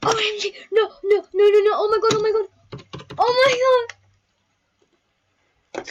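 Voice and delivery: loud high panicked yells; screaming. Real, not acted. Vlogger screaming at her roll result, loud and high. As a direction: shout it out in disbelief.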